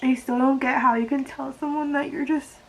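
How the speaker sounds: crying over the breakup; real crying. Real, not acted. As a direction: push the words out through tears. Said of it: "why am I crying about this".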